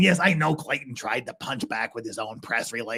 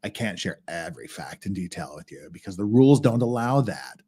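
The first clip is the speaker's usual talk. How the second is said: ordinary talk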